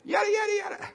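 very high, loud, shrill imitation of a girl calling. Preacher mimics a girl shrilly calling sheep. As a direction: shriek it up high to catch the sheep's attention. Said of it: "in a loud, shrill voice".

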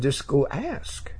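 gentle invitation. Retold or imitated. Quoting Jesus gently.

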